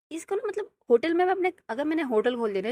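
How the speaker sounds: loud, high, exasperated outburst about the brother; angry rant. Real, not acted. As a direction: blow up at him, really fed up. Ranting about useless brother. (गुस्से में ऊँची आवाज़ में भड़कना)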